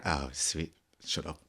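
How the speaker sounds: low, soft camp 1940s posh accent. Shown as a demonstration. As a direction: camp old-time posh, underplayed. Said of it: "camp, like, it's very".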